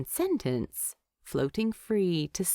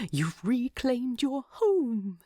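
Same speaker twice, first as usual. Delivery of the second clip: higher, slow, tender hobbit voice; fond, earnest; 6 semitones higher, slower than usual. A narrator's reading. Bilbo tries for a tender moment with Thorin. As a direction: savour the moment for him. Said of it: "trying to have a nice moment".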